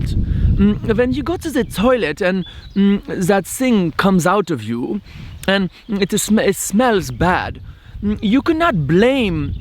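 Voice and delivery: a hammed-up German accent with thoughtful mm's. Spiritual teacher explaining karma to seminar devotees in a German accent. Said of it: "So he was saying with his German accent".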